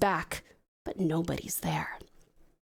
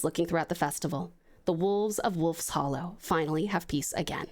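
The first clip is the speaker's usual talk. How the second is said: usual speaking voice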